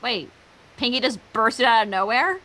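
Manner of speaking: usual speaking voice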